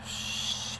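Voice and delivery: breathless under-the-breath swear; realising she forgot the prisoner, whispered curse. Acted. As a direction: mutter it as it dawns on you.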